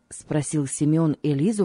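plain reading voice

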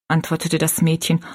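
usual speaking voice

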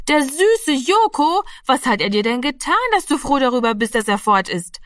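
put-on high, indignant child protest; vorwurfsvolle Geschwister. A narrator's reading. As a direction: pounce on the sister in high-pitched reproach. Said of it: "fielen die Geschwister über sie her". (vorwurfsvoll, empört)

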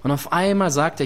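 normal talking voice

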